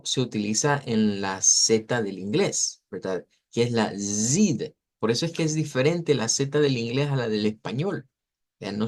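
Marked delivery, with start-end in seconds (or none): 4.0-4.6 zid with a voiced, vibrating z; buzzed English z. Shown as a demonstration. Teacher showing English z differs from Spanish, buzzed zid. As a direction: start zid on a buzzing English z. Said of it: "con esa vibración".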